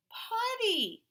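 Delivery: put-on high, loud little-boy cry of a word; high toddler exclaim. A narrator's reading. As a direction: squeal it like a tiny child. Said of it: "Marcos said".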